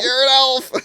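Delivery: high, loud taunting shout; Buddy shouting back at the elf; mock yell. Buddy replying to threat.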